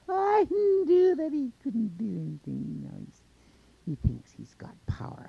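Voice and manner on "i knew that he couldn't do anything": very high, loud gloat; high, triumphant monster voice; crowing monster. A narrator's reading. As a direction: gloat at the top of the voice. Monster crows after Coyote fails.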